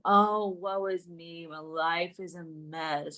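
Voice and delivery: slow, low, hammed-up moaning; mock self-pity. Mocking creators who are always depressing, slow low whine.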